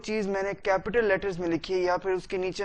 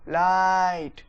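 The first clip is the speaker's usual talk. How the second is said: Light held long and louder, falling; stretched stress; 5 dB louder, much slower than usual. Shown as a demonstration. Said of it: "बस उसको delay करना है". (Light को खींचकर ज़ोर से)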